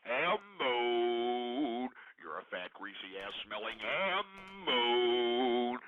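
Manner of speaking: low, slow drawn-out taunt of ham bone; taunting a shopper on a scooter, low and slow; mock taunt. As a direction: sneer it down low at a passerby. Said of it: "pass by these fat assholes and say".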